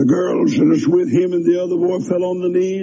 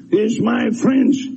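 ordinary talk

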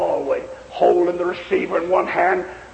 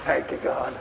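hushed thanks; 6 semitones lower, 7 dB quieter than usual. Retold or imitated. Whispered thanks as boy revives.

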